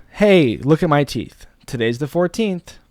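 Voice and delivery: slow, emphatic hook; showy, deliberate video read; expressive script rehearsal. Pretend.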